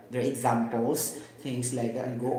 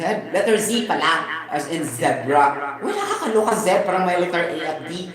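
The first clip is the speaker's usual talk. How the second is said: laughing; 3 semitones higher, 7 dB louder than usual. Real, not acted.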